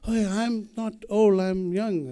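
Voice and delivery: retold indignant denial; mock protest. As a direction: protest it in a high voice. Acted protest to the Frenchman.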